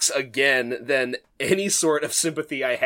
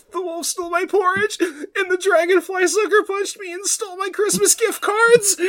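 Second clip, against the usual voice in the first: high, laugh-sobbing wail; mock sobbing kid. Budulinik wailing to Granny, mock sobbing. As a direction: blubber it out like a whiny child.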